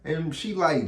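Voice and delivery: normal talking voice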